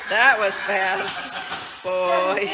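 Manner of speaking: excited. Real, not acted. Excited loud slow.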